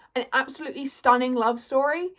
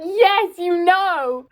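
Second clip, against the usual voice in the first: excited agreement; 5 semitones higher, 6 dB louder, much slower than usual. Retold or imitated. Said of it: "And I was like".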